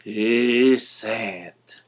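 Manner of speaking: croissant clipped to a low unvoiced "sant"; low breathy mangled croissant; grandma's mispronunciation. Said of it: "It still came out".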